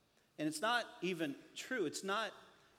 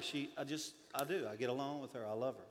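dropped low and soft, tender; 7 semitones lower, 7 dB quieter than usual. Real, not acted. Pastor quietly confessing love for his wife. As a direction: say it softly like a confession.

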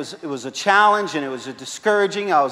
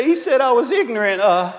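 put-on higher whiny child's voice; upset little girl; 5 semitones higher than usual. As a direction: complain like a hurt five-year-old.

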